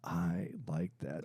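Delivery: slow, soft whispered relish. Real, not acted. Savoring the accent, whispered.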